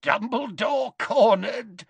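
high, drawn-out gloat; giggly gloating villain. A narrator's reading. Lopsided Death Eater gloating over Dumbledore, giggly. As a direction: crow it slowly in a high villain voice. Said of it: "gave a wheezy giggle".

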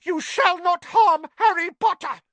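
high loud elf shout; squeaky elf yelling a threat; shouting house elf. Acted. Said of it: "But Dobby shouted".

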